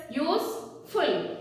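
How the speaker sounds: "useful" drawn out slowly; slow careful teacher word; slow modelling. Shown as a demonstration. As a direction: say "useful" slowly so children hear it.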